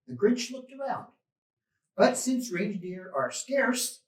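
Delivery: ordinary delivery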